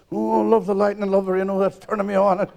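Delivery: mock-swooning punter getting carried away; hammed-up ecstasy. Man aroused, light and rain.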